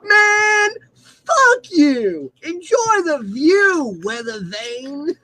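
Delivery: high, slow sobbing tantrum; whiny Vader. Acted. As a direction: wail it like a sulking villain. Acting Robot Chicken scene.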